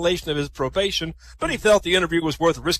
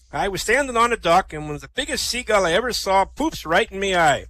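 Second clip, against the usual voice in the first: raised pirate storytelling voice; Sol; 4 semitones higher, 3 dB louder than usual. Acted. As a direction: spin the tale like an old sea dog. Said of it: "Two pirates, Morty and Sol".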